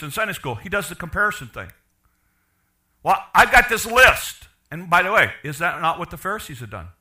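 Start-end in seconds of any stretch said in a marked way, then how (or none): 3.0-4.5 slow, loud, self-satisfied; smug list-keeper. Retold or imitated. Older brother boasting his record to father, smug. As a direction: savor having the list.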